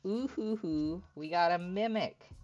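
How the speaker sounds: high, loud delighted whoop over the pulled card; excited whoop. Real, not acted. Streamer pulling a Mimic from the pack, excited whoop. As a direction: crow with glee at a good pull.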